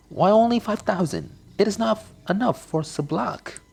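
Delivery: whiny daughter. Acted. Daughter begging for more money.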